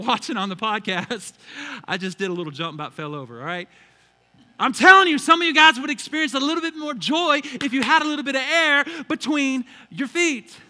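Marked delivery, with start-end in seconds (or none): 4.6-10.6 much higher and louder exhortation; preaching at full force. Real, not acted. Pastor urging the congregation to jump for joy, loud. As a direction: push the joy hard. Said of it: "preacher exhorting".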